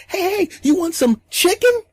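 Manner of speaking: put-on very high, eager kid chatter; little kid voice. The boy greets everyone while offering food, very high and eager. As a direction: bounce it up high like an excited kid.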